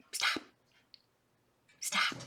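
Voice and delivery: soft, low-volume order to a pet; quiet little scold; hushed command. Real, not acted. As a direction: tell the pet quietly to quit. Repeats order to pet, softly.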